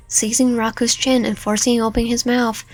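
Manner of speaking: ordinary delivery, nothing marked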